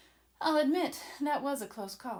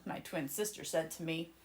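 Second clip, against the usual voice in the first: ordinary delivery, nothing marked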